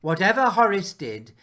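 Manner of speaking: ordinary delivery, nothing marked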